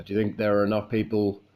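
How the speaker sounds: ordinary talk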